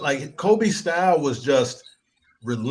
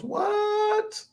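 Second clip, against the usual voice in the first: squeaky falsetto what, far higher; mock disbelief. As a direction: fake shock, way up in falsetto. Mock disbelief at eight finals.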